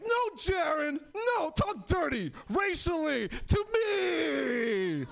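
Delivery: put-on girlfriend shouting at him to talk dirty; mock demand.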